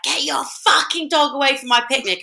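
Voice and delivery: imitating an old lady's angry shout; mock angry yell. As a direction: bark it like a furious picnicker. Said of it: "she started screaming at me".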